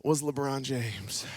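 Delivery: slow, low and soft; deadpan punchline. Acted. Preacher joking about Akron to the crowd, deadpan. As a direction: let the name land dry.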